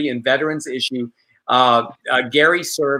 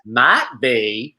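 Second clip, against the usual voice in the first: put-on character voice; 2 semitones lower than usual. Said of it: "I'm gonna turn into one of my characters".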